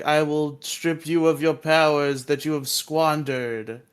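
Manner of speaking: loud, slow villain pronouncement; slow, booming mock-villain threat; Lord Zed. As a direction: proclaim it like a menacing overlord. Voicing villain Lord Zed in recap.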